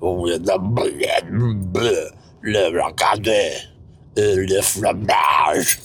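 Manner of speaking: low, slow French accent with a frog's throat; round, bulbous Dickensian-gentleman French; throaty French accent. Shown as a demonstration. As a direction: blob your throat out into exaggerated French. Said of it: "That's how I sound French".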